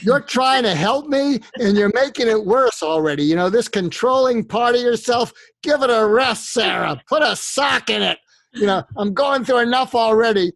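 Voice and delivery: loud and high, venting; fed-up husband. Acted. Husband loudly telling his wife to give it a rest.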